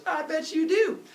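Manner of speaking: put-on high, loud little-kid voice; high-pitched baby salesman; sly baby Hermes. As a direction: goad him like a scheming toddler. Baby Hermes baits Apollo.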